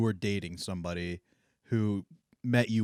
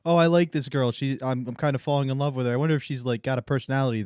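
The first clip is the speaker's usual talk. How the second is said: louder, higher acted infatuation; smitten fan; 5 semitones higher, 5 dB louder than usual. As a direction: gush like a fan developing a crush. Acts out a smitten YouTube fan.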